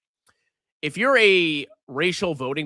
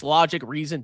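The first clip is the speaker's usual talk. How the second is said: ordinary delivery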